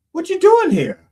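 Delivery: loud casual God, What you doing here, a misread; wrong casual reading. Acted. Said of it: "I'm sorry, sorry".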